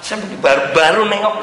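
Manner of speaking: ordinary talk, nothing marked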